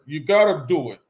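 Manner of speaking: normal talking voice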